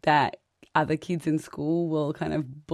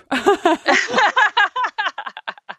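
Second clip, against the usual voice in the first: loud, high burst of real laughter; a big giggle breaks out; 13 semitones higher, 7 dB louder than usual. Real, not acted. Mother laughing at husband's answer, loud high laughter.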